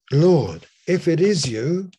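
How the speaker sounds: loud, urgent call across the water; the bold disciple calling out loud; Peter calling out. Retold or imitated. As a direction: cry out boldly to the Lord. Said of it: "Peter says".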